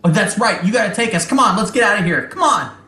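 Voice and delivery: high, fast goblin urging; pushy goblin hurrying everyone; eager goblin voice. Acted.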